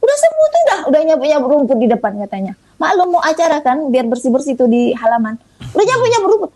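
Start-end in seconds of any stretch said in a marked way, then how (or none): 0.0-2.2 high-pitched, quick report of news; excited sister. Retold or imitated. Sister on the phone reporting Papa's recovery, excited. 5.7-6.5 high-pitched imitation of astonished news; excited sister. Sister telling her sibling about Papa sweeping, excited.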